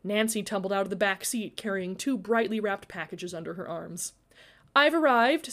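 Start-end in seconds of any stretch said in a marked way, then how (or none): 4.8-5.5 cool. A narrator's reading. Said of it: "Sydney said coolly".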